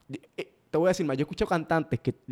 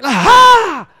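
much higher, loud explosive ad-lib yell; 16 dB louder than usual. Retold or imitated. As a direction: blast it out like a hype man. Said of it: "entonces sale aquí".

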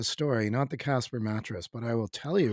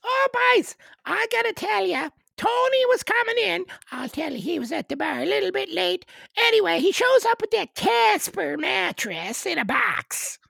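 very high, loud Newfoundland storyteller; Newfoundland accent; 8 dB louder than usual. Acted. Newfoundlander tells the boys about Tony's late arrival, high Newfoundland accent. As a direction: spin a yarn in a high, loud Newfoundland caricature. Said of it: "it's an Irish sort of accent".

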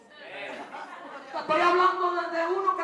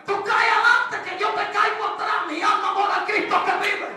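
higher, louder preaching that builds to shouts; preaching at full force; 4 semitones higher, 6 dB louder than usual. Acted. Preacher telling women ministers to be wives at home.

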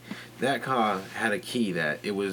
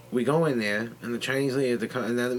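ordinary talk, nothing marked

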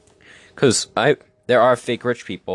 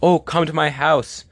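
higher, boastful mock voice; mock show-off guy; 6 semitones higher than usual. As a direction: brag like a guy inviting a date home. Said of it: "what are you gonna say to your date".

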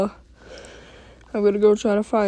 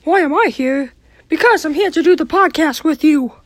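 Mickey Mouse voice; 7 semitones higher than usual. Acted. Character voice opening skit.

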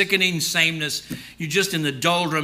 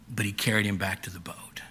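whispered, softer; hushed whisper; 7 semitones lower, 8 dB quieter than usual. A narrator's reading. Preacher whispering as Jesus carries Peter back. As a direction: whisper the rescue. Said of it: "hushed narration of rescue".

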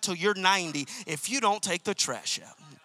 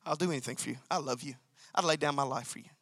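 soft, lower devoted vow; tender devotion; 6 semitones lower, 7 dB quieter, faster than usual. Shown as a demonstration. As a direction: say it to her like a quiet promise. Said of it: "with this attitude".

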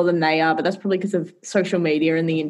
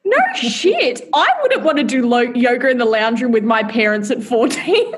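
laughing; 3 semitones higher than usual. Real, not acted. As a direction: giggle your way through the retort. Laughing at mum's yoga story.

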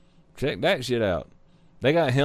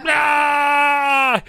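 E.T. yell; 10 semitones higher, 4 dB louder than usual. Retold or imitated. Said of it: "Remember E T ... he was like".

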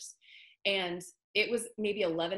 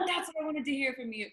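put-on Australian accent; 6 semitones higher, faster than usual. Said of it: "I can't do a good Australian accent".

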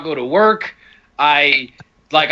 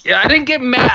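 ordinary talk

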